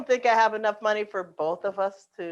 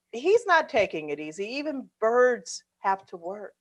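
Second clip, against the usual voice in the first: ordinary talk, nothing marked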